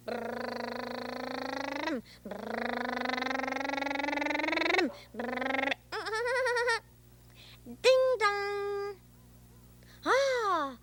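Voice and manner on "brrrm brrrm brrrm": rolling lips like a car engine; engine noise. Pretend. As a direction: pretend taxi motor. Boy imitates taxi engine.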